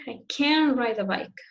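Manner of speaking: negative "can" emphasized over "ride"; stress on CAN'T. Shown as a demonstration. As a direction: put the weight on "can", not on "ride". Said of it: "The importance is in the negative".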